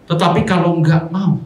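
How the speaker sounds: usual speaking voice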